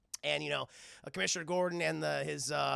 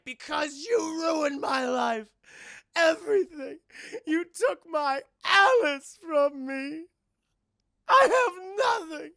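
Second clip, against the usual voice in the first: much higher, loud slow wailing lament; crying shout; 10 semitones higher, 9 dB louder, slower than usual. Retold or imitated. Mad Hatter blaming Batman, crying out. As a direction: break down as you blame him. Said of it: "And he starts crying".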